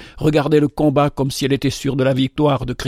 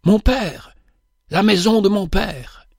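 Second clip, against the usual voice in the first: high, overwhelmed cry of recognition; a son suddenly recognising his father's house, crying out overwhelmed; frantic cry; 6 semitones higher than usual. A narrator's reading. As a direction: cry out, beside yourself with emotion. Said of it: "m'écriai éperdu".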